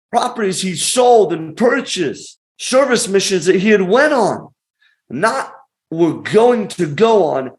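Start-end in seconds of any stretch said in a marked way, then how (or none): none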